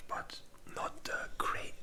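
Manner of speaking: usual speaking voice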